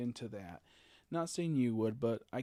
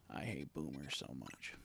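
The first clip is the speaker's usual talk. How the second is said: muttered; 9 semitones lower, 10 dB quieter than usual. Real, not acted. Fading end of the rant.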